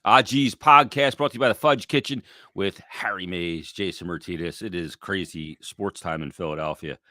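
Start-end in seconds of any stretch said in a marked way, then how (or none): 2.6-7.0 quiet golf announcer hush; soft, like calling a golf tournament; hushed tones. Real, not acted. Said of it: "Why, why are you in hushed tones?".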